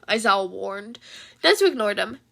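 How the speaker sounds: plain reading voice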